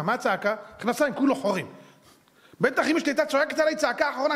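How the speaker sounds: normal talking voice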